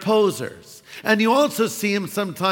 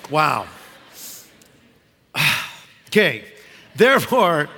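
ordinary talk

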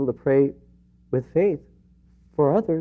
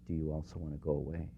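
almost a whisper of Jesus; soft voice of Jesus; 6 semitones lower, 13 dB quieter than usual. Acted. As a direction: lower and much softer. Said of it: "hear his voice when he says".

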